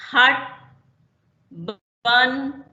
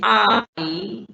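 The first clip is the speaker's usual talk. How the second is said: आई, माई, सेल, school said slowly, syllable by syllable; teacher giving syllable examples slowly; much slower than usual. Shown as a demonstration. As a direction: stretch each example word out. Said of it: "जैसे".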